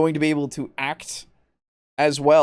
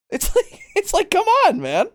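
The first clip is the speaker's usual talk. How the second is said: pitched way up, exasperated; high exasperated. Real, not acted. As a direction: let it squeak out in disbelief. Exasperated outburst.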